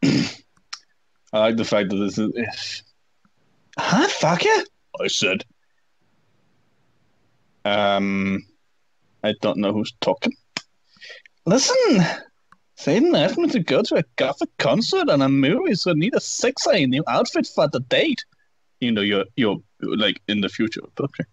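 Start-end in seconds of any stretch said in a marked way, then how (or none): 3.8-4.6 very high mocking squeak; high-pitched teen. Reading fanfic quote. 11.5-18.2 put-on high whiny teen voice; whiny emo teen. Reading fanfic quote.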